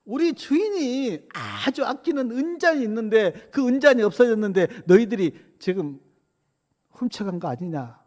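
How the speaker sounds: steward's high-pitched accusation; high acted reproach, settling at the end; acted rebuke. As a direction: scold them as the accusing steward, starting high and easing down. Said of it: "청지기가 가서 책망합니다".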